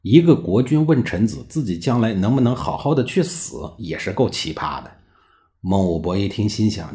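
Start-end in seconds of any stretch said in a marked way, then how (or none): none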